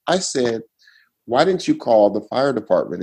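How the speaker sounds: ordinary talk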